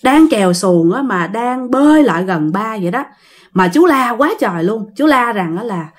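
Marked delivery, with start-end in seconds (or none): none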